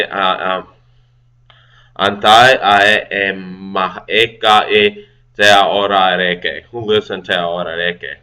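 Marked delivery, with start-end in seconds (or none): 2.0-6.6 slow careful reading. Shown as a demonstration.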